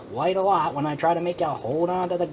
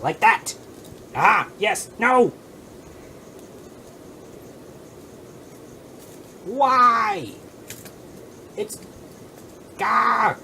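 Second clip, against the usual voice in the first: excited outbursts; 6 semitones higher, slower than usual. Real, not acted. Excited then dismayed outbursts.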